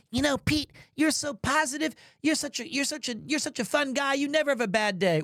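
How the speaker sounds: mock the chirpy compliments everyone gives; imitating admirers. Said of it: "Everyone goes".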